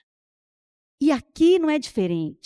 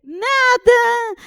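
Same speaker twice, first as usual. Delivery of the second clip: higher, pointedly sulky "Nada" with a long fall; 9 semitones higher, 3 dB louder than usual. Retold or imitated. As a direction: say "Nada" so the hurt shows openly. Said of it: "meu nada tava assim, escancarado".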